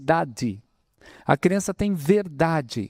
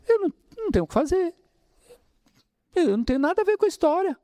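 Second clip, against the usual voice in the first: imitating a blame-dodger, higher and fast; desculpa debochada; 9 semitones higher, much faster than usual. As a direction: rattle off the excuse in a mocking, carefree voice. Mocking someone dodging blame. (imitando com deboche quem se livra da culpa, mais agudo e rápido)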